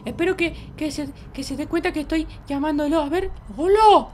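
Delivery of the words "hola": loud hail into the night; calling out. Pretend. Child calling for someone at night, loud hail. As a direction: shout hello to someone far away.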